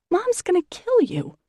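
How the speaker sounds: high, shocked squeal; aghast. A narrator's reading. Sister squealing at her reckless brother, high shocked. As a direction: squeal it out in disbelief.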